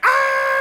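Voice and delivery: high, loud breathy yelp of pain; hammed-up cry as his arm is twisted; a cartoonish scream of pain; mock pained scream. Guy's arm pinned to wall.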